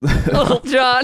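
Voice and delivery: squeaky falsetto, slow; high squeaky voice. Acted.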